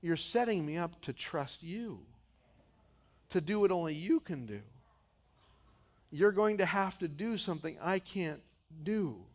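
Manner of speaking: soft, humble words of trust to God; quiet trust. Acted. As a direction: say it gently as surrender. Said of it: "Rather than saying".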